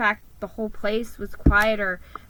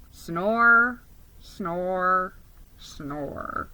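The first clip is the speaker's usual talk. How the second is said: fading sleepy snores; sleepy voice trailing away; 2 semitones lower, much slower than usual. A narrator's reading. Falls asleep over book.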